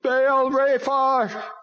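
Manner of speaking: high, loud, very slow demon cry. A narrator's reading. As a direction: stretch the name out in a demon cry. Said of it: "Bobby cried".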